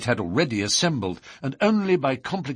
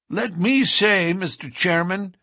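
put-on older lodge brother; 10 semitones higher, 4 dB louder than usual. A narrator's reading. Older brother addresses the chairman, high and deliberate. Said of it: "said one of the older brethren".

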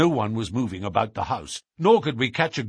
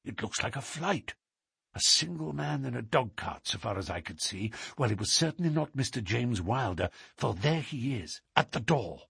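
hushed; 7 dB quieter than usual. Acted. Watching hidden in the dark.

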